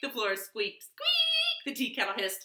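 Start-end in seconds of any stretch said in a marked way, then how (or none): 0.8-1.6 high squeak. Pretend. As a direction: squeak like a bed spring. Squeak effect.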